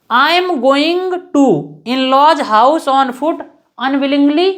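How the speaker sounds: very slow, word by word, spacing each phrase; slow dictation. Shown as a demonstration. Teacher dictating an example sentence to students, very slow. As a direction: spell it out slowly for students to copy. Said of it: "dictating the example slowly".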